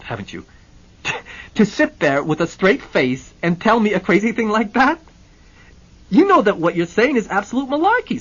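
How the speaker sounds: loud, high incredulous bluster; a businessman sputtering with disbelief; voiced indignant client; outraged Hennessy.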